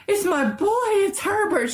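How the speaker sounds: high, frantic cry; desperate mother calling her son. A narrator's reading. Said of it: "she cried".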